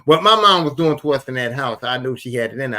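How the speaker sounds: usual speaking voice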